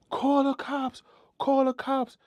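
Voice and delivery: high, desperate yell; screaming for help. Retold or imitated. Robbed woman yelling at the dozing guard to call the cops. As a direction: shout to wake the dozing guard. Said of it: "when he heard me scream".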